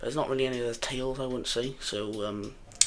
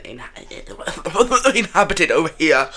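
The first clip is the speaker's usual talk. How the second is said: laughing stumble; 5 semitones higher, 11 dB louder, much slower than usual. Real, not acted. Stumbles on a word.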